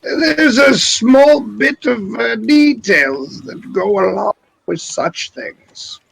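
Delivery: slow, drawn-out wizard in a put-on accent. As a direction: stretch it out like a hedging wizard. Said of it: "no hint of any wizard voice".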